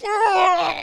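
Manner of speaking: high startled cry; high loud yelp; dad's yelp. Retold or imitated. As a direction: yelp like a grossed-out dad. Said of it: "the dad's just like".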